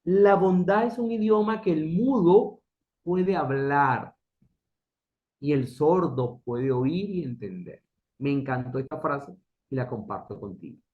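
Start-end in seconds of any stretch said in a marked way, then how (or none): 0.0-7.8 lee la cita lentamente, saboreando cada palabra; cita leída despacio. Shown as a demonstration. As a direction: pausa larga antes de "puede hablar", casi declamando. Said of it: "lo dice así". (declamación lenta y solemne de la cita)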